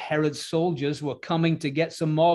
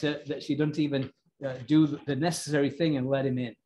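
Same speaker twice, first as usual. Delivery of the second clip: ordinary talk, nothing marked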